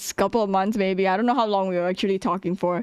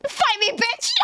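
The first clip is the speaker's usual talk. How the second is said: mock challenge; 11 semitones higher than usual. Retold challenge, cracking up.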